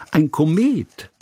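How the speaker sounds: high, drawn-out cry of discovery; exclaiming. A narrator's reading. Hanaka spots the kite over the door, exclaiming. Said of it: "rief Hanaka".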